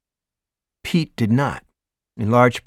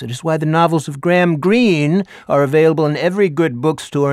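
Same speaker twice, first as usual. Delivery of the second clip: higher, louder, grandly orating schoolteacher; theatrical teacher; 6 semitones higher, 6 dB louder than usual. Acted. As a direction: perform the lecture like a showman teacher. Teacher Ricker lecturing class.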